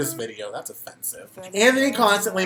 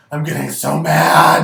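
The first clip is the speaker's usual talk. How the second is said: louder, building anger; louder angry; 10 dB louder than usual. Shown as a demonstration. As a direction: say it again much louder.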